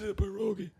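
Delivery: put-on high-pitched, joke Italian accent; mock Italian accent. Posing as a hungry Polish camper, mock Italian accent. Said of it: "It's also an Italian accent".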